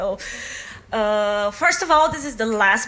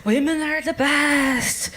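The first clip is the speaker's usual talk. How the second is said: mock feminist rally; 3 semitones higher, slower than usual. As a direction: ham up a ranting activist. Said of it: "very feminist and like".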